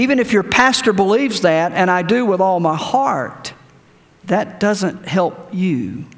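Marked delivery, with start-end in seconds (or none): none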